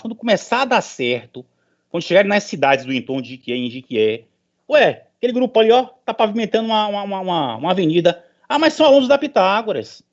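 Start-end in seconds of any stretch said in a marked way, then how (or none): 4.7-8.2 imagined surprise. Pretend. Imagined onlookers remarking. 8.5-10.0 imagined onlooker. Pretend.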